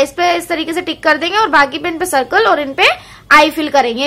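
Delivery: ordinary talk, nothing marked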